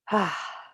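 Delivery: relaxed, falling "ah" sigh; releasing sigh. Shown as a demonstration. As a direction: open exhaled sigh of release. Models letting go after tensing.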